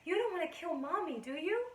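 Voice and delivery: put-on high, lilting question to a little child; high-pitched mommy guilt trip; mother coaxing child. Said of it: "I'd tell him he was hurting me".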